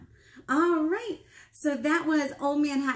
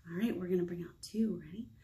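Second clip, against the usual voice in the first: whisper; 6 semitones lower, 7 dB quieter, faster than usual. Acted. Quiet cue between song verses.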